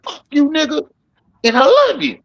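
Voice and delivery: high put-on uncle voice cussing then loving; mock uncle voice; uncle impression. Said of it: "and be like".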